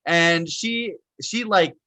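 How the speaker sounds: ordinary delivery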